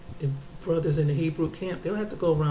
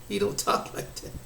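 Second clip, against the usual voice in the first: real laugh breaking through the words; 5 semitones higher, 6 dB quieter than usual. Real, not acted. Speaker dismissing an imagined militant quote, laughing. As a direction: let breathy laughter take over the line.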